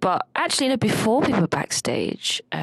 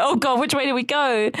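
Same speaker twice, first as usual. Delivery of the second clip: high panicked question; 6 semitones higher than usual. Retold or imitated. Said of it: "and I'm like".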